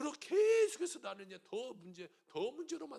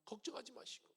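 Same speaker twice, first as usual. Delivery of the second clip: soft whispered reassurance; tender whisper; whispered comfort; 3 semitones higher, 10 dB quieter than usual. Real, not acted. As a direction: hush them gently.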